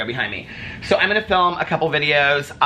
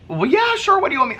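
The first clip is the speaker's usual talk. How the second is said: eager yes; 13 semitones higher, faster than usual. Retold or imitated. Eager reply to waitress.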